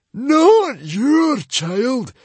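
very high, loud, slow screech; a high, slow, furious screech. A narrator's reading. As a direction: screech the denial, drawing it out. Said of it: "screeched the librarian".

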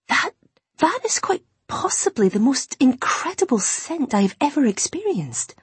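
high, airy, halting wonder; falter, then gush in hushed amazement; breathtaken awe. A narrator's reading. Imogen inhales the flowers' scent, high and awestruck.

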